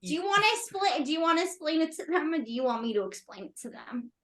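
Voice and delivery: usual speaking voice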